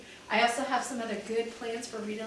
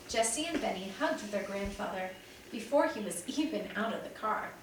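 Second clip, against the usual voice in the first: ordinary delivery, nothing marked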